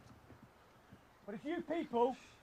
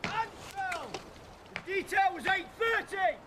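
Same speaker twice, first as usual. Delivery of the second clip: angry bark; 5 semitones higher than usual. Real, not acted. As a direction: tear into the recruits. Instructor berates recruits at inspection.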